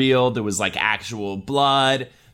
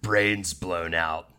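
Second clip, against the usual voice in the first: ordinary talk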